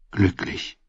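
soft, unvoiced murmur to himself; inner thought. A narrator's reading. Narrator voicing a man's thought about red hair, softly murmured. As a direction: let the word fade out softly, as a thought. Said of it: "dachte er".

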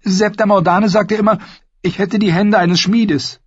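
put-on high, girlish Arya voice; young girl voice. A narrator's reading.

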